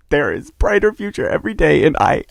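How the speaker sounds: loud, high-pitched proclamation; emphatic declaration. Pretend. Vlogger repeating a hopeful line to the audience, emphatic declaration.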